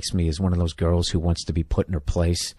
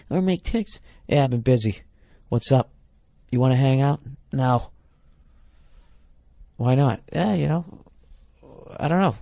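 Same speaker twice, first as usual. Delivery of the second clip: higher, bored, brush-off voice acting the call; dismissive whatever vibe; 7 semitones higher than usual. Man acting out blowing off the girl on the phone, mock dismissive. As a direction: play it cool and uninterested on the phone.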